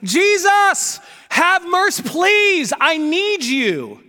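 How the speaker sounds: high, loud pleading shout; desperate cry. Acted. Beggar crying out to Jesus, high and loud. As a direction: plead with everything you have. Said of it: "He kept saying".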